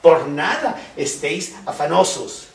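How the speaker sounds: versículo dicho más fuerte; proclámalo con peso; cita enfática. Acted. Predicador cita la Escritura con fuerza. (cita proclamada con fuerza)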